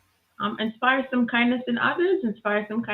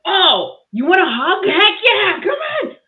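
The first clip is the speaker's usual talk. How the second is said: loud, high, eager re-enactment; climbing higher into an excited yell; excited exclamation; 11 semitones higher, 8 dB louder than usual. Retold or imitated. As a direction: burst out with delighted eagerness. Acted eager offer of a hug.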